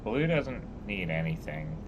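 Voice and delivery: ordinary talk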